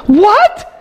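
high, incredulous what; mock disbelief. Preacher reacts to John bowing to the angel with high mock shock.

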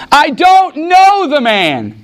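high, drawn-out acted protest; emphatic denial. Peter swearing his denial.

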